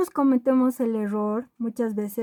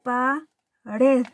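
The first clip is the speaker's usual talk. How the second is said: Pared in two parts, pa, pause, red; teacher dividing Pared as pa - red; split into syllables. Shown as a demonstration. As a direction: break Pared apart syllable by syllable. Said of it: "Divido en sílabas".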